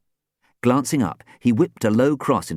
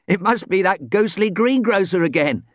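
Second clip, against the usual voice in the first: ordinary delivery, nothing marked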